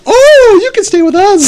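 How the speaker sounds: put-on very high, breathy-free swooning girl voice. The girl inviting the buff cousin, high swooning falsetto. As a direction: gush at the handsome guy. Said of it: "the girl's like".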